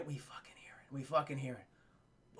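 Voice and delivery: hushed, low storyteller's whisper; suspenseful whisper. Retold or imitated. Telling chat about the approaching footsteps, in a suspenseful whisper. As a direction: drop to a whisper to build dread.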